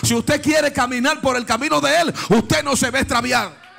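high, loud revival preaching; fiery preaching. Real, not acted. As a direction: preach it out to the congregation. Preaching at full force.

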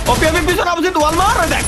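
sharp high warning shout; urgent callout. Real, not acted. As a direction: yell the enemy position fast. Urgent callout of enemy behind. (জরুরি চিৎকার করে সতর্ক করা)

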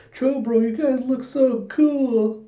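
very high, drawn-out fangirl gush; imitated gushing squeal; [name], admiring.

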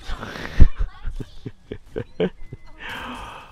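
airy unvoiced chuckle; a quick breathy laugh. Real, not acted. Laughing at teammate play.

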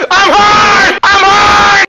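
high screaming shout; high shriek. Acted. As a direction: shriek it at the top of your voice. Shrieking in anime voice.

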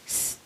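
"s" as a sustained voiceless hiss; S sound. Shown as a demonstration. Teacher showing students the word ending, "s" hissed alone. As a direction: sound "s" alone, not the letter name. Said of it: "tận cùng bằng các âm như sau".

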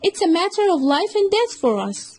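a cunning little fox pleading urgently; sly fox. Acted. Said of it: "said to him".

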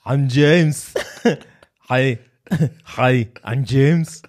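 bad German-Austrian action-star impression; mock German accent; Arnie. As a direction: put on a thick Austrian accent, amused. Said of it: "I'll do, I'll do Arnie".

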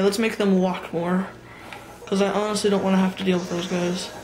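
ordinary talk, nothing marked

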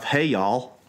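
easy Southern US greeting; Southern accent. Shown as a demonstration. American guest showing British hosts his local greeting, Southern accent. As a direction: say it like a Southerner, relaxed. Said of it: "as I say down here in the South".

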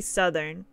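"Southern" with a short u, SUH-thern; American Southern. Shown as a demonstration. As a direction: say "Southern" as SUH-thern when talking about the States. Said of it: "you have to say".

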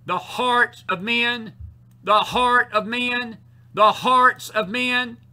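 slow, high, loud drawn-out refrain; full-force preaching. Real, not acted. Preacher circling the heart of men, full-force preaching. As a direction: stretch each word and proclaim it over and over.

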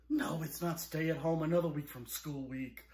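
normal talking voice

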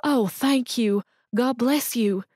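high, slow thanks through tears; tearful thanks. Acted. Sobbing mother thanking Stuart for groceries, tearful. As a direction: pour out gratitude while crying. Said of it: "The woman began to sob".

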